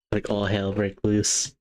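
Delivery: normal talking voice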